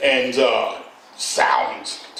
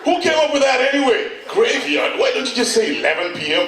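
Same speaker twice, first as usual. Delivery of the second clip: incredulous; 9 semitones higher than usual. Retold or imitated. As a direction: throw up your hands in disbelief. Incredulous outburst.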